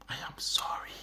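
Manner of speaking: low, soft lead into a whisper; hushed apology. Retold or imitated. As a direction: whisper the apology as a private prayer. Voicing a penitent's prayer to God.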